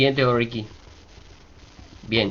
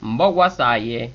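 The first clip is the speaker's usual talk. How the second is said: higher, ringing recital of a Yoruba prayer; oriki recitation; 4 semitones higher, 6 dB louder, faster than usual. Shown as a demonstration. Teacher reciting an oriki for learners, ceremonial recitation. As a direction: proclaim the praise verses phrase by phrase.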